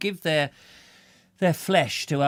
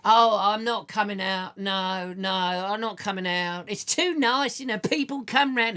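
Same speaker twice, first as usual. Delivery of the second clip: higher, put-on patient's voice; voicing the patient; 7 semitones higher than usual. Host voicing a viewer who won't leave hospital, higher put-on voice. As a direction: play her as a contented patient refusing to leave.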